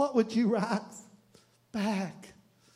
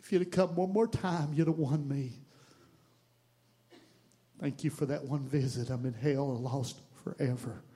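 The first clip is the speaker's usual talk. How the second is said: low and softer, dying away; fading soft letter; 7 semitones lower, 8 dB quieter than usual. Acted. Neighbor signing off from hell, softer and low. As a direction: let the letter trail off into hush.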